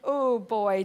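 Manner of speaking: normal talking voice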